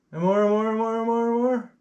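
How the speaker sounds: high chant that keeps climbing; mounting excitement. Acted. Presenter watching the snows keep coming, mounting excitement. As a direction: pile up each repetition, rising in pitch. Comic escalation as snow piles up.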